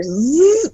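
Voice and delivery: imitated zipper buzz; attempted unzipping sound effect; zipping sound. Shown as a demonstration. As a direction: make a drawn-out zip noise. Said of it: "how to do a zipping sound".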